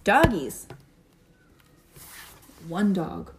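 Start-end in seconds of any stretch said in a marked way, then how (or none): none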